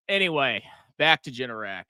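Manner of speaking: normal talking voice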